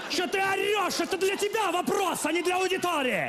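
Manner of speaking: high, laughing exasperated scold. Acted. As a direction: scold him, half laughing. Teacher scolds the shouting student.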